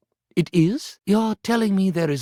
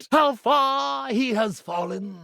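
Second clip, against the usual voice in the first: slow, higher theatrical cry of self-pity; dramatic lament; 8 semitones higher, slower than usual. Acted. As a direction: wail it slowly to the rafters. Retake of the same lament.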